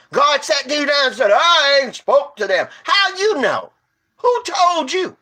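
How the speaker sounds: worked-up street preacher; heated challenge. Real, not acted. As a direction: press her hard, voice climbing. Arguing with the religious lady.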